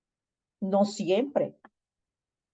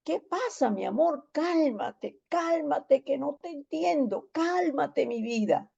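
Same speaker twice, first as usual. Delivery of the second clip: soothing; 7 semitones higher, 3 dB quieter, slower than usual. Retold or imitated. Pastor calming a crying girl.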